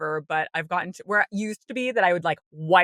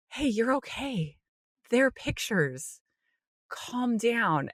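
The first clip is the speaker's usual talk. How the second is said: softer, slow, soothing; reassuring self-talk; 4 semitones higher, 5 dB quieter, slower than usual. Retold or imitated. Comforting herself before speaking to the hygienist, soft and reassuring. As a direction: talk yourself down gently. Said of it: "go to Empowered and say".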